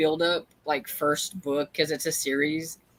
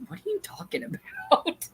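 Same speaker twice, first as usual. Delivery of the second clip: baffled. Retold or imitated. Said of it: "sometimes I'm like".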